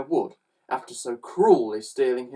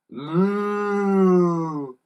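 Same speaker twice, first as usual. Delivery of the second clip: long, raised moo; alarmed cows; 6 semitones higher, 4 dB louder than usual. A narrator's reading. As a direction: moo like a startled cow. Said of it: "cried the cows".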